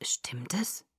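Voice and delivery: very soft, low whisper. A narrator's reading. Father asking the doctor privately, whisper. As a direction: whisper it as a confidential question. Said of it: "flüsterte Charlie".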